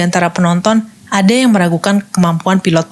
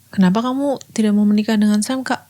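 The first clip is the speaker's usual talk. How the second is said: softly voiced younger sister asking; light, softer voice for a curious little sister; Muriel; 3 semitones higher, 5 dB quieter than usual. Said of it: "menceritakan hal ini pada adiknya". (suara adik bertanya, pelan)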